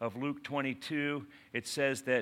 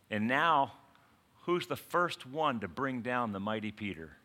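normal talking voice

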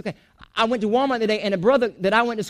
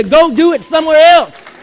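louder and higher, a preacher's shout; forceful preaching shout; 5 semitones higher, 9 dB louder than usual. Real, not acted. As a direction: hammer it home at full volume. Preacher driving point home.

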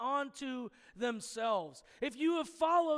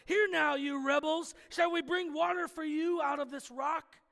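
loud, higher mock rebuke; angry rebuke; 4 semitones higher, 5 dB louder than usual. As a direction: bark it at the rebels. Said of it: "They were so mad".

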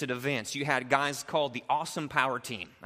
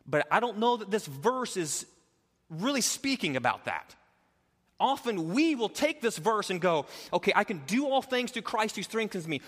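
full-force preaching; 8 semitones higher than usual. Acted. Pastor preaching verse thirteen to the church at full force. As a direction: preach it out to the congregation with full conviction.

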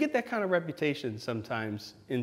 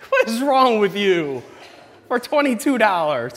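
loud disbelief; 7 semitones higher, 8 dB louder than usual. Acted. Disbelief at Band-Aid stunt.

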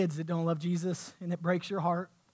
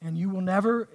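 ordinary talk, nothing marked

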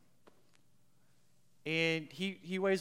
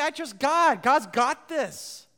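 mock easy confidence; 8 semitones higher, 11 dB louder, slower than usual. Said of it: "It's easy to say".

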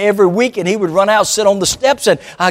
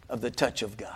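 hushed climax; 9 semitones lower, 18 dB quieter than usual. Acted. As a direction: drop to a near hush for the final line. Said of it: "Don't miss this".

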